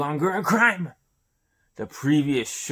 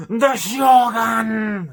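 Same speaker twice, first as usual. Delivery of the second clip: slow, much higher full shout; shouted prophecy; 12 semitones higher, 11 dB louder, much slower than usual. Acted. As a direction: bellow the final word of the prophecy. Climax of the prophecy.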